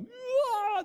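high-pitched mock shriek of "ah"; creeped-out cry. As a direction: squeal as if creeped out. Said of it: "that's creepy".

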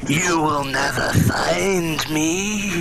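slow eerie alien monster voice; Mind Flayer voice. Acted. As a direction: drag each word out like a lurking mind monster. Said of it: "my best imitation of the Mind Flayer voice".

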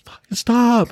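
high-pitched mock yell at a dog; retold yell. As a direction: imitate an exasperated owner yelling. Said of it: "Just yell at their".

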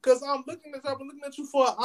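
high-pitched imitation of the girlfriend; girlfriend accusing. Girlfriend tells him she watched him for an hour, high mocking voice.

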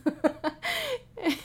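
a burst of high laughter. Real, not acted. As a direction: laugh out loud at herself. Laughing at daughter's reaction.

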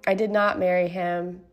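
usual speaking voice

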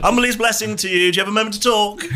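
quick, bright charity-collector pitch; street fundraiser. Acted. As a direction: rattle off a chugger's pitch. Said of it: "just going".

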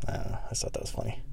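breathy muttered aside; half-whispered afterthought. Real, not acted.

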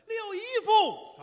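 very high, loud, drawn-out holler; hammed-up shout for a relative; absurd calling out. Shown as a demonstration. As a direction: bellow it slowly at high pitch. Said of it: "没有这么喊的".

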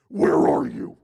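"Where are you?" barked very loud and low, "are" hit hard; angry demand. Shown as a demonstration. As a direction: snap it like an accusation. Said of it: "what I hear in my head is".

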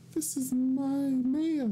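put-on very high, slow girly voice; girl voice as Maya. Host tries a voice for the game character, girly falsetto. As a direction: try out a falsetto girl voice, drawn out. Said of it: "You haven't had a girl voice yet".

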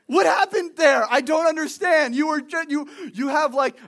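baffled outburst. Acted. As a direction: exclaim in disbelief at the change. Mock bafflement at prayer voice.

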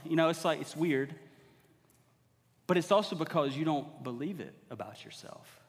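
usual speaking voice

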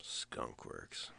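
very soft, low, voiceless murmur; muttered to self. Real, not acted. Co-host looking up Skunk Works, muttering to himself. Mumbling while looking something up.

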